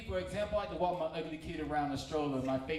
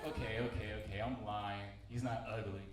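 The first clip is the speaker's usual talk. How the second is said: sheepish admission; 7 semitones lower, 5 dB quieter than usual. Retold or imitated. Caving in, admitting the lie.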